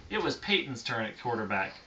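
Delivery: ordinary delivery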